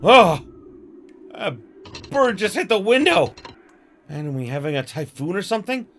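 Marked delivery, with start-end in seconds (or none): 0.0-3.3 louder and higher, a sudden jolt of fright; startled cry. Acted. Girl startled by a bird hitting the window, sudden alarmed cry. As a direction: gasp it out as if the bird just struck.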